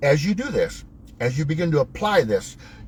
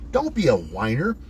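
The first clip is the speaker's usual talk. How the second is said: ordinary talk, nothing marked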